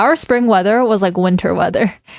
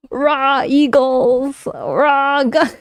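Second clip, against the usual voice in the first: mock cheering American, pitched up high, whooping Rah; rowdy American patriot; 5 semitones higher, slower than usual. Streamer mocking Americans cheering for their country, hammed-up and high. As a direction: ham up a flag-waving yee-haw patriot. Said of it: "you get Americans coming out being like".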